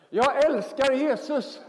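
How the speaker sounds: put-on high, slow, laughing little-girl call; child calling out. Daughter calling down to dad, high joyful slow call. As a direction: sing it out like a delighted four-year-old. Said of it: "Så ropar hon ner".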